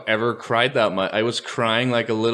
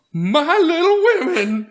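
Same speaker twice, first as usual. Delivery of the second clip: high, slow, grand mock father voice; pompous mock dad; 6 dB louder, slower than usual. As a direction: ham up the dad's big line. Mocking the dad's line.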